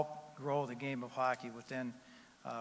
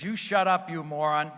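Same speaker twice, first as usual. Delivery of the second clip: loud, higher angry yell; husband yelling; 3 semitones higher, 11 dB louder than usual. Retold or imitated. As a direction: yell her down. Husband yells at wife in joke.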